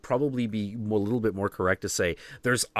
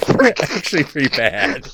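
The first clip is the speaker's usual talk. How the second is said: louder, laughing repeat; 4 semitones higher, 7 dB louder than usual. Shown as a demonstration. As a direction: say it again through a laugh. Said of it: "laughs before repeating".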